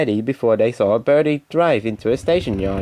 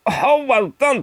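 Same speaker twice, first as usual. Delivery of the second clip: delighted exclamation; 12 semitones higher than usual. Acted. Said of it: "exclaimed his driver".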